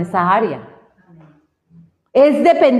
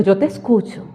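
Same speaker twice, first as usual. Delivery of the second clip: lower, softer answer; God's reassuring reply; 4 semitones lower, 4 dB quieter than usual. Retold or imitated. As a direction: drop the voice into quiet reassurance. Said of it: "el Señor dice".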